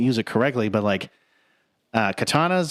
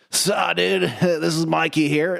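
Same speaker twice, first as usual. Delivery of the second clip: higher-pitched Southern California surfer dude; drawn-out SoCal dude greeting as a turtle; Mikey's surfer accent; 5 semitones higher, slower than usual. Acted. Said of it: "he speaks with a Southern California dialect".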